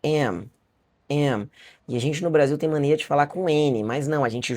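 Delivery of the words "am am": "Am" closed with both lips shut at the end; pronunciation demo. Shown as a demonstration. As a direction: model "am" ending on a firm m. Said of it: "Am é você falar como se fosse assim".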